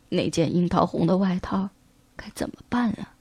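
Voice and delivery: put-on a frail old man worrying aloud; worried old tailor.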